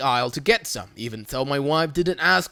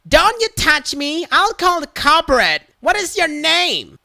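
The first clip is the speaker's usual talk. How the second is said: much higher, loud shrill threats; threatening Karen; 10 semitones higher, 5 dB louder than usual. Retold or imitated. Karen threatening to report the worker, high and loud. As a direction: threaten to call corporate.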